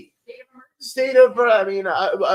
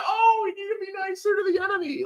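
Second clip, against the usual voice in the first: high-pitched mock complaint; mocking protest; 11 semitones higher than usual. Mimicking people urging niceness to the enemy, high mocking voice. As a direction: parrot them in a high, scornful whine.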